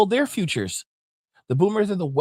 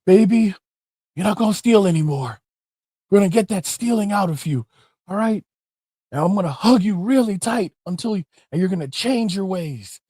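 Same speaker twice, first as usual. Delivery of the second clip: higher, louder coddling coo; Umar Johnson impression; 4 semitones higher, 4 dB louder than usual. Mocking Umar Johnson consoling the thief, higher mock coo. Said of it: "I can't really do Umar, the Umar impression".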